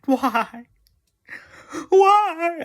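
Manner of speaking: high, drawn-out cry of despair; mock wailing. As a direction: wail it loud and high in hammed-up grief. Mock wailing over character deaths.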